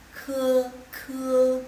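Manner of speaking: ke with the e pushed loud; stressed vowel. Shown as a demonstration. Teacher showing native-style stress on the vowel, loud. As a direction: lean hard on the E of "ke". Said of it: "Nah, penekannya di E".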